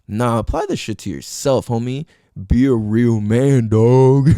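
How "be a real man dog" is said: loud, slow mock macho command; teasing male listeners with a bro challenge; mock tough bro. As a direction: ham up a macho bro order. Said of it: "I'm just kidding".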